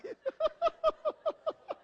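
high, loud laughter; laughing on. Real, not acted. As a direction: keep laughing. Still laughing at the punchline.

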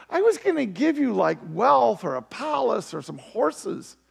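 a king balking in disbelief; indignant king. Acted. King voiced refusing the request.

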